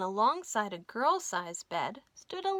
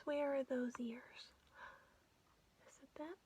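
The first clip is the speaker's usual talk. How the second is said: whispered aside; 2 semitones higher, 9 dB quieter than usual. Shown as a demonstration. Reader aside to child listener.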